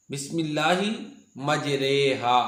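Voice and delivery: मजरेहा with the re leaning towards e; imala demo. Shown as a demonstration. A Quran teacher demonstrates the imala in मजरेहा. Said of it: "ये एक जगह पे इमाला है".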